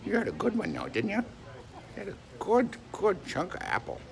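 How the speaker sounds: high cooing pet talk. Real, not acted.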